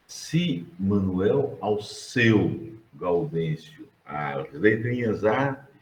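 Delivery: Manuel Alceu Gaudêncio said very slowly, stressing each initial; teacher slowly spelling the name. Shown as a demonstration. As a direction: stretch out Manuel Alceu Gaudêncio to show the letters. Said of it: "spelling out the initials".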